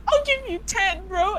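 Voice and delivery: high-pitched eager retelling; mock eagerness. Teller's inner reply to the customer, mock eagerness. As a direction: pitch it up, playfully keen.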